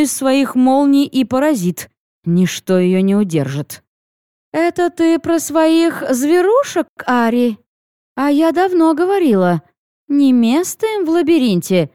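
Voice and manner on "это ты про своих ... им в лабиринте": higher, teasing goddess voice; a lightly voiced playful goddess. A narrator's reading. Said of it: "раздался голос Ирилун".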